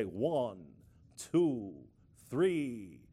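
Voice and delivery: lightly imitated Count accent, each number on its own; Count's accent. Teaching children to count, in the Count's accent. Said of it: "he had an accent".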